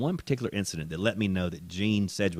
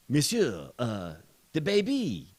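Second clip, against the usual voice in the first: hesitant French gate agent; light French accent, drawn out at the end; mock French accent; 2 semitones higher, slower than usual. Acting French gate agent, high falling calls.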